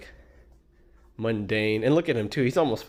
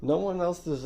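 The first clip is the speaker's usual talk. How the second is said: heated interruption; 8 semitones higher than usual. Real, not acted. Host cutting in to contradict the guest. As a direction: cut him off indignantly.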